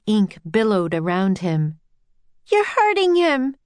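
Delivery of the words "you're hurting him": high distressed yell. A narrator's reading. Annie defending the octopus, high yell. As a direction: protest at a high pitch. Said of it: "yelled Annie".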